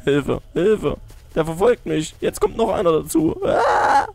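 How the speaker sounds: high panicked cry; panicking gamer. Real, not acted. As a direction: scream in panic while fleeing. Chased by enemies.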